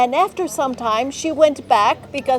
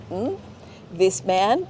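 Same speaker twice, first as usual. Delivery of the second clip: ordinary talk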